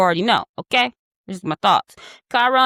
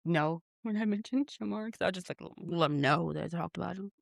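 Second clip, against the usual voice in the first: much softer apologetic aside; quiet aside; 4 semitones lower, 10 dB quieter, faster than usual. Real, not acted. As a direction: drop to a soft aside to the audience. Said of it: "I just did it again".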